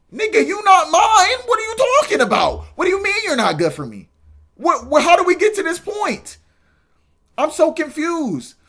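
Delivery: very high, loud disbelief; incredulous squeal. Real, not acted. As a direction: shriek it in total confusion. Incredulous high-pitched protest.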